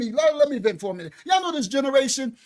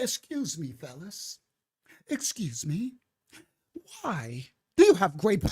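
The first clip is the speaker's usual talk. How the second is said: soft, much quieter mock-polite voice; 7 semitones lower, 6 dB quieter than usual. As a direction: pretend to be meek and overly polite with the thugs. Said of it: "be all nice and calm".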